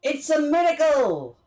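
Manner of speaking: high, delighted exclaiming; excited celebration. Real, not acted. Streamer cheering that it worked, excited and high. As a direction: burst out in triumph.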